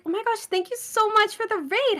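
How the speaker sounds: high sweet customer service voice. Retold or imitated. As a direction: gush over a raid. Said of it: "it's like".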